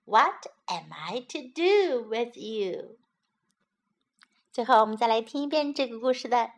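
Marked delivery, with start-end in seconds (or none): none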